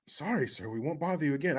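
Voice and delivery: low, soft, cowed caller; meek apology. Acted. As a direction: back off quietly as a scared collector. Intimidated bill collector apologises.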